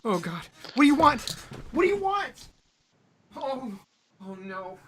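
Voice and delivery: high panicked hammy shrieks; mock terrified screaming. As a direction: scream as if attacked. Fake attack on host.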